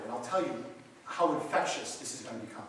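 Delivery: ordinary delivery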